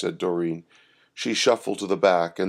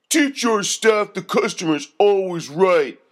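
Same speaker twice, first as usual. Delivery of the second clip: high, loud thug complaining; whiny thug griping about service; 9 semitones higher, 3 dB louder than usual. A narrator's reading. First thug complaining to the manager, high and loud. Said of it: "said the second".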